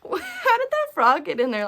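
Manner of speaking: retelling her shocked question at the coop; shocked disbelief. Retold or imitated. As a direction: replay the shock of finding the frog. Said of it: "I was like".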